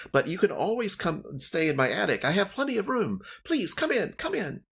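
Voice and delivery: high, bubbly halfling hostess; cheerful town speaker, pitched high; Nimsy Huddle. Acted. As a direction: welcome them in a squeaky eager voice.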